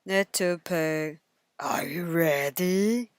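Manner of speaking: low, slow wolf call; deep and slow at the door; wolf calling the pig; big bad wolf.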